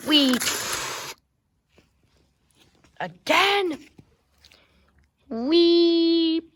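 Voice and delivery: high, loud squeal of fun; playful whee while crashing; gleeful wee. Pretend. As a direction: shriek with joy as you fly. Train flying off again.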